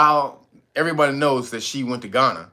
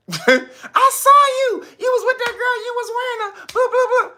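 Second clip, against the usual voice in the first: very high, loud accusing falsetto; hammed-up high accusing girlfriend; shrieking girlfriend; 5 dB louder than usual. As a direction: squeal the accusation like the angry girlfriend. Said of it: "And she said".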